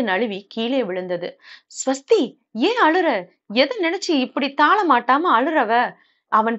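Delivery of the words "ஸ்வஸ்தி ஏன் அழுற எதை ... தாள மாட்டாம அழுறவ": higher-pitched, worried questioning; alarmed concern. Acted. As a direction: ask urgently why she is crying. Husband alarmed at wife's sudden crying. (பதறிய கவலையுடன், உயர்ந்த குரலில்)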